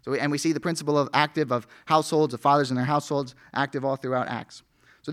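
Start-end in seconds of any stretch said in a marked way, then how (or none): none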